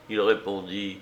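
ordinary delivery, nothing marked

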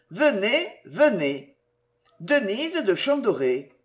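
mother reading the news in alarm; urgent telegram. Acted. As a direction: read the telegram as a frantic plea. Urgent telegram read aloud.

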